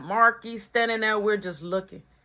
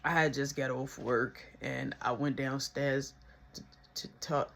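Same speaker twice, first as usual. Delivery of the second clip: much softer, low, trailing excuse; nervous mumble; 8 semitones lower, 9 dB quieter than usual. Retold or imitated. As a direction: mumble the excuse under your breath.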